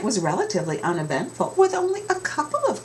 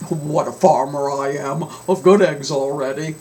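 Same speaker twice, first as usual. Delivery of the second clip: mild farmer shout; 2 semitones lower, 4 dB louder than usual. Acted.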